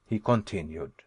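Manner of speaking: usual speaking voice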